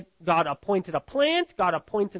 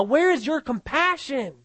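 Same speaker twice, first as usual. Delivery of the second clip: higher, raised demand on where; 6 semitones higher than usual. Acted. God confronting Jonah over the plant, raised rebuke. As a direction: throw the question at him, higher.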